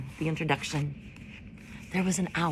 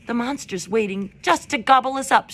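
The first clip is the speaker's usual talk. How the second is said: louder, a bit higher fearful shriek; shrieking groundhog; 5 semitones higher, 5 dB louder than usual. A narrator's reading. Groundhog shrieking in fear, louder and a bit higher. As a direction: panic about being eaten. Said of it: "shrieked Dig Down".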